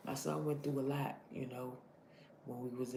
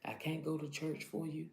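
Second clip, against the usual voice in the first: usual speaking voice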